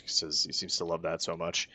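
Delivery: usual speaking voice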